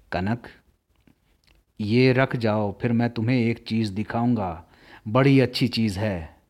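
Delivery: tender and affectionate. A narrator's reading. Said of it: "स्नेह के स्वर में कहा". (स्नेह भरे धीमे स्वर में)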